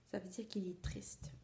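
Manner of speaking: soft whispered explanation. A narrator's reading. Explains word to child.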